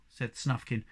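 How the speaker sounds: ordinary delivery, nothing marked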